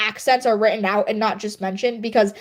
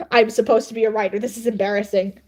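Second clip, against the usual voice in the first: ordinary talk, nothing marked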